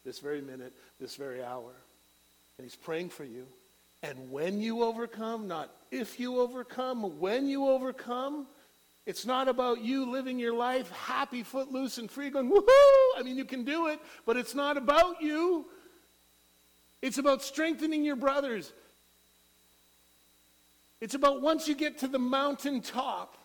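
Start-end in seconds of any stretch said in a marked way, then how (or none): 4.0-12.5 much higher, louder climax preaching; preaching at full force. Acted. 12.5-13.2 very high, very loud carefree whoop; mock whoop. 13.2-23.4 much higher, louder climax preaching; preaching at full force. Acted.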